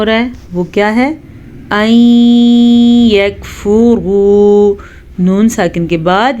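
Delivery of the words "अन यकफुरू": slow, drawn-out tajweed chant; Quran recitation. Shown as a demonstration. Teacher reciting for students. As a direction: intone the Arabic slowly.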